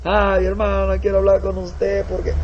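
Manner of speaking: high, whimpering plea to the missionary; a grown man whining through tears; whiny crying brother. Acted. Brother comes crying to the missionary, high whimper.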